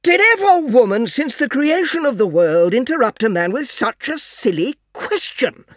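husband reined in but irritated; exasperated father. A narrator's reading. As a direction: scold the interruption, barely holding back. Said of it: "taking care to moderate his voice".

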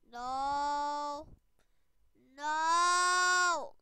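loud, drawn-out no; frustrated wail. Real, not acted. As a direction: cry out no in dismay. Frustrated cry while losing at game.

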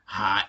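HAI with a rough, constricted throat; strident vowel. Shown as a demonstration. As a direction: squeeze the glottis on the vowel of HAI. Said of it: "another word with a, s-, a strident vowel".

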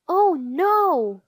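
loud, high storybook alarm; dismayed cry. A narrator's reading. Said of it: "cried Arthur".

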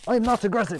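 "I'm not aggressive" high, in one run; high-pitched protest. Pretend. Player protesting he is not aggressive, high quick denial. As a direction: protest it high without breaks. Insists, then repeats word by word.

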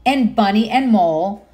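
gentle storybook narration; reading to children. Acted. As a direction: read it like bedtime to little ones. Reads picture book aloud.

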